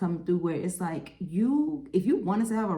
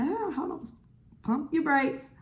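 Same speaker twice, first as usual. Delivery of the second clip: high, sassy mock retort; mock brake-pumping; 10 semitones higher than usual. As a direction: squeak it out like you're cutting them off mid-flirt. Acting own reply to eager suitors.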